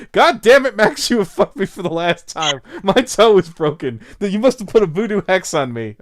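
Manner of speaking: loud, high, furious mock rant. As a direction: rant at Max over a stubbed toe. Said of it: "I'm gonna pop on Twitter and be like".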